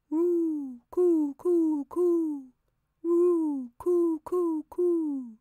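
high cooing of a dove; high imitated woo and coo of a dove. A narrator's reading. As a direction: coo like a pigeon on the veranda. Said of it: "sounded from the veranda".

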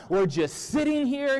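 high, loud yell of complaint; worked-up yell. Acted. Restless king rants to his entourage, yelling. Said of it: "he yells".

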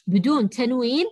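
ordinary delivery

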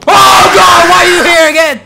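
loud, high panicked cry; frightened shout. Real, not acted. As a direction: yell at the creature in real fright. The figure returns.

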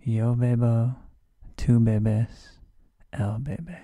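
loud, measured call for repetition; leading repetition. Shown as a demonstration. Teacher leads students in conjugating bebo, loud repeat-after-me. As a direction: lead the class loudly, word by word. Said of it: "Say it with me".

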